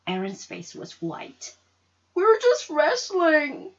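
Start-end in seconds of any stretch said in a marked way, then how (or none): 2.1-3.7 put-on high, slow, small voice of a scared little boy; little brother excusing himself after the fall. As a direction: plead it like a guilty kid.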